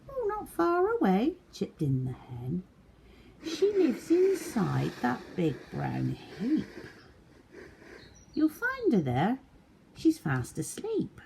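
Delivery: very high clucking hen; high fussy hen. A narrator's reading. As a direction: sell out the duck in a hen's voice. Hen again.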